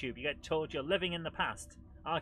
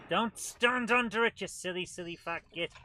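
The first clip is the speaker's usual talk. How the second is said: shouting at character; 4 semitones higher, 7 dB louder than usual. Real, not acted. Yelling at game character.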